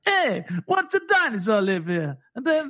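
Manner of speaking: hammed-up Italian-American narrator; Italian-American accent. Mimicking the film's odd opening voice-over, mock Italian-American accent. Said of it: "Den der italienske accent der".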